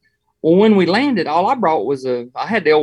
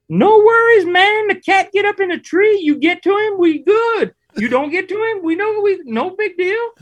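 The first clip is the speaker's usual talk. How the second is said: very high surfer-dude voice, laid back; high-pitched surfer dude; 4 dB louder than usual. Retold or imitated. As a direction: squeak it out like a mellow surfer guide. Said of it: "he's got a real high-pitched voice".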